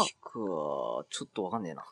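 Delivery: low, softened voice with a slight character-actor touch; a faint hint of a voice actor's low delivery; slightly Wakamoto-like. Real, not acted. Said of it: "今なんか若干若本に". (若干若本風の低い声で)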